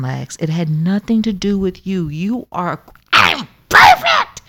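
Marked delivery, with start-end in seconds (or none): 3.1-4.4 very high, loud, slow squeal; Lexi. Acted. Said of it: "Hi, I'm Lexi".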